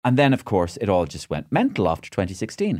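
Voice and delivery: usual speaking voice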